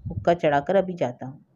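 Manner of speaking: ordinary delivery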